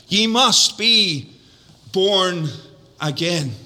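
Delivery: "Ye must be born again" very slow, each word weighted; slow emphatic preaching. Acted. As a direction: drop the pitch and level on "again". Preacher stresses the key text.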